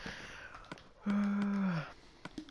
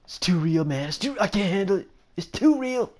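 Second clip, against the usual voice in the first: hammed-up panic, rising higher and louder; mock overwhelmed gamer; 5 semitones higher, 4 dB louder than usual. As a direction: cry out as if it's all too much. Acts overwhelmed gamer in virtual reality.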